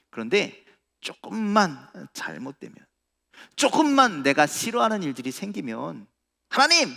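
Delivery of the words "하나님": loud, high-pitched cry; calling out to God. Acted. Preacher acting us calling on God when troubled, crying out. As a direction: cry out in complaint, voice leaping up.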